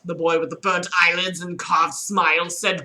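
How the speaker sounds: ordinary delivery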